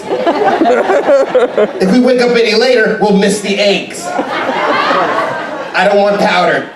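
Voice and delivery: way up high, bouncy wake-up call; overeager cruise director. Acted. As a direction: chirp it like a morning cheerleader. Cruise director waking passengers up.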